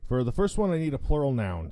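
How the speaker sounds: ordinary delivery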